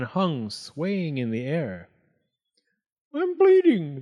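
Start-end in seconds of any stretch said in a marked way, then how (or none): 3.1-4.0 very high, loud yelp; shrieky panicked outburst. A narrator's reading. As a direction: cry out in alarm on waking. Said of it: "the fox yelped".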